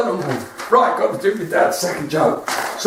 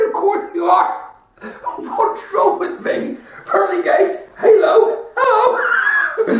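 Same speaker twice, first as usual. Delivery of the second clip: much higher, flamboyant and laughing; camp Saint Peter; 10 semitones higher, slower than usual. Retold or imitated. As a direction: show off the halo with a flourish. Peter camping it up.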